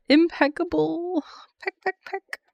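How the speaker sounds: high, playful chicken-pun voice; playful clucking. Pretend.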